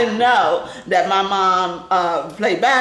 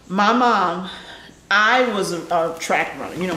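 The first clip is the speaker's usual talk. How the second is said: ordinary talk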